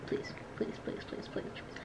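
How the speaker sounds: breathy whispered pleading; whispered begging. Real, not acted. Gamer begging the lobby to start, whispered plea. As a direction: whisper please over and over.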